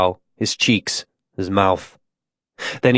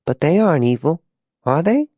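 put-on high child voice, lifting at the end; small, high, uncertain voice; doubtful little girl; 5 semitones higher than usual. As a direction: question it like a troubled child.

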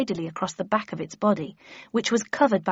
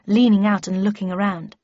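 usual speaking voice

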